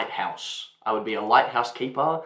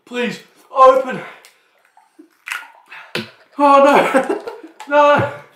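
high, loud desperate pleading; desperate pained shout; 12 semitones higher, 9 dB louder, slower than usual. Real, not acted. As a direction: wail in panic from the heat. Can't open drink while burning.

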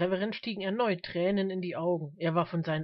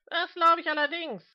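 high, loud, cutting call; biting heckle; 10 semitones higher, 6 dB louder than usual. A narrator's reading. Mister Smith heckling the reverend, biting high shout. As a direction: call out with as much bite as possible. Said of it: "rief Mister Smith mit möglichst beißender Stimme".

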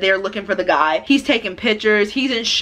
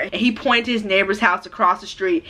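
ordinary delivery

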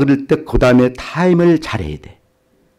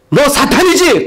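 stern challenge; 11 semitones higher, 4 dB louder, slower than usual. Retold or imitated. Pastor confronting possessed girl.

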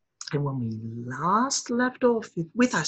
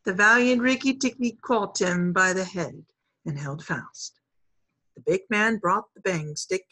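ordinary delivery

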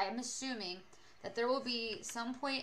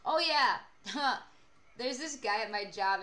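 usual speaking voice

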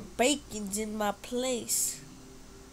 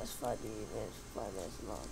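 much lower, softer aside; low mutter; 14 semitones lower, 10 dB quieter than usual. Real, not acted. Player complaining to self, low quiet mutter. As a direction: mutter it to yourself.

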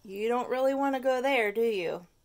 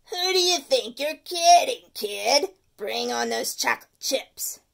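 loud, pushy candy craver; demanding tooth; 2 semitones higher, 9 dB louder than usual. Acted. The tooth demanding peanut butter cups, loud. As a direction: bark for candy like a greedy monster.

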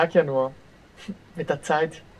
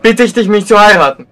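loud proposal; 2 semitones higher, 11 dB louder than usual. Acted. Loud formal marriage proposal.

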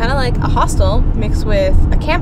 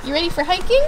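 talking to a pet; 7 semitones higher than usual. Real, not acted. Cooing at the dog.

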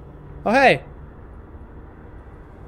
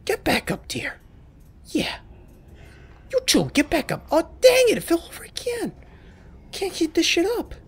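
squeaky dude voice; 12 semitones higher than usual. Retold or imitated. Player voices a game creature whose block tower keeps falling, high and squeaky. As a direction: play a silly little guy fretting over fallen blocks. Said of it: "And he's like".

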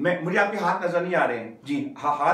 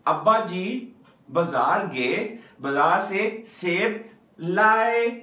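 slow teaching sentence, लाए stretched out; stress on लाए; 2 semitones higher, slower than usual. Shown as a demonstration. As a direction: land firmly on a long final लाए. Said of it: "किस पे emphasise किया हुआ है".

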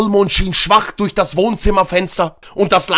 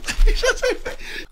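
high breathy laugh; 14 semitones higher, 4 dB quieter than usual. Real, not acted. Cracks up reading fanfic.

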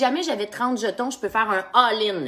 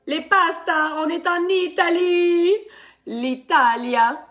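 hammed-up Sicilian Italian accent, much higher, louder and slow; mock Sicilian accent; 9 semitones higher, 6 dB louder, much slower than usual. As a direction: announce Italy with a theatrical fake Sicilian accent. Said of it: "Donc, mon accent, ... sicilien".

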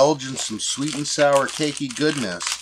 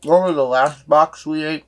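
speaking after a bite; chewing while talking; 2 semitones higher, 3 dB louder than usual. Real, not acted. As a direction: hand over mouth. Seen 0: Hand covers mouth, jaw working after eating snack, until 1.9s.

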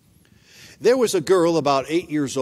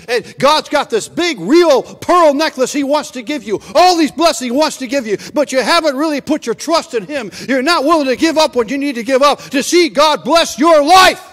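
louder, higher, building to a shout; preaching at full force; 7 semitones higher, 7 dB louder than usual. Real, not acted. Preacher urging the congregation to give up what holds them back, building to a shout. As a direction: drive the call home to the church.

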